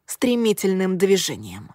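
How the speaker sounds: plain reading voice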